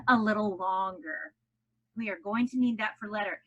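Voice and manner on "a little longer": held longer. Shown as a demonstration. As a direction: hold the sound in "longer". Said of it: "holding the sound".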